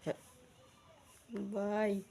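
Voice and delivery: ordinary delivery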